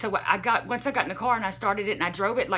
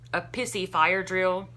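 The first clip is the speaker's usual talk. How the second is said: ordinary delivery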